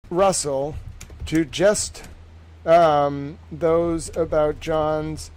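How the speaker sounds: slow, halting dictation with long pauses; dictating a summary. Real, not acted. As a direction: compose it aloud phrase by phrase while writing. Composes a summary aloud while writing.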